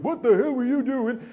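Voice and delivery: high-pitched mock outraged shout; angry demand. Big hotel guest confronts him in the hallway, angry.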